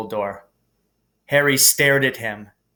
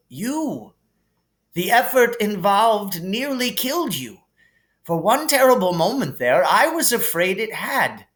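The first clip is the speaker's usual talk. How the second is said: higher, gently amused headmaster voice; kindly old headmaster; 8 semitones higher than usual. Acted. Dumbledore calming Harry in the hospital wing, gently amused. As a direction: soothe the boy like a kindly wise old wizard.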